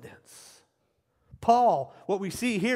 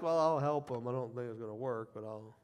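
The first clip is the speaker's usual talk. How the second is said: mumbled, trailing off lower and softer; doubtful, reluctant agreement fading away; half-hearted helper; 6 semitones lower, 5 dB quieter than usual. Acted. Preacher mimics an unconvinced helper, half-hearted and sinking away. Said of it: "or are you like".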